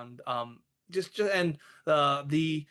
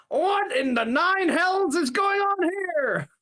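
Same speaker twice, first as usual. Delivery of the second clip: high, drawn-out outraged shout; outraged Carl; 5 dB louder than usual. Acted. Carl walking in on the destroyed room, outraged bellow. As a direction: roar it slowly, way up in pitch. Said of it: "From Carl".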